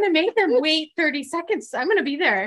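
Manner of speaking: high, loud eager voice; eager mock promise. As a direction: chirp it out like a dutiful friend. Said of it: "She's like".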